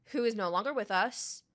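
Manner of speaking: usual speaking voice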